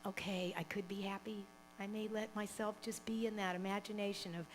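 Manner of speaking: soft, whispered musing; tentative hushed thought; hushed inner voice. Retold or imitated. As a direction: whisper a shy hope. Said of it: "this idea of".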